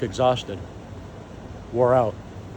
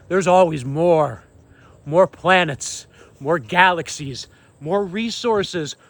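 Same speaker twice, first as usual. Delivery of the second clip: raised, emphatic preacher's delivery; fervent proclamation; 5 semitones higher than usual. Real, not acted. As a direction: proclaim it forcefully, phrase by phrase.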